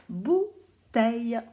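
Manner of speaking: very slow syllable by syllable bouteille; slow and clear. Shown as a demonstration. Teacher dictating the word slowly. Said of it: "Alors, je vous dis".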